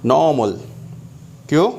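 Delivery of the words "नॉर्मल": नॉर्मल with silent R, "naw-mal"; नॉर्मल, long vowel straight into M; British pronunciation. Shown as a demonstration. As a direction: drop the R in नॉर्मल. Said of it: "and here normal".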